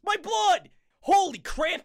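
loud, high hammed-up cry; mock shout. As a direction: bellow it out in fake horror.

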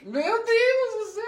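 high, slow shriek through laughter; laughing shout. Real, not acted. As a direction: scream it, half laughing, half horrified. Reacting to friend gagging.

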